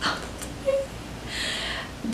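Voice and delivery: high, slow, airy gasp of wonder; awed whisper. Retold or imitated. Fan retelling the final snowboard run, awed whisper. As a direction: breathe it out, stunned. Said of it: "I was just like".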